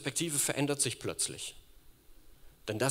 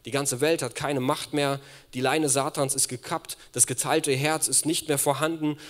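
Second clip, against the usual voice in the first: loud, rising sermon climax; preaching at full force; 3 semitones higher, 6 dB louder than usual. Real, not acted. Preacher rallying the congregation to freedom, loud and fervent. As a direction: drive the freedom home to the church.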